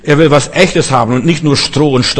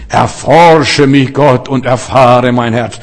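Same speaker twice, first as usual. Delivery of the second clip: slow, drawn-out devout praying voice; pious prayer; 3 semitones lower, slower than usual. Acted. Preacher mimics a devout believer asking God to search him, piously. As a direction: pray it with exaggerated piety, each word stretched. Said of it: "So fromm kann nur ein Frommer beten".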